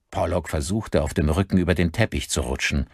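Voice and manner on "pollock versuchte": usual speaking voice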